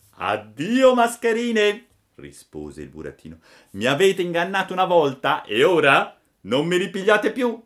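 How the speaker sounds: a cheeky boy mocking two swindlers; scornful puppet. A narrator's reading. Pinocchio rebuffs the beggars.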